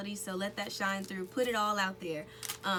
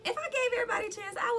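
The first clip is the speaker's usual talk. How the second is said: high, fast, louder, dissolving into laughter; laughing; 4 dB louder, faster than usual. Real, not acted. Singer joking about chances with producers, laughing. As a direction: let the laugh take over the line.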